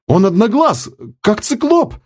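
high excited cry; exclaimed. A narrator's reading. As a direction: shout in awe at the cyclops eye. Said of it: "воскликнул связист". (высокий поражённый возглас)

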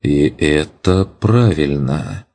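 slow, thoughtful drawl. Acted. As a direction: drag it out while thinking. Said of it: "задумчиво протянул я".

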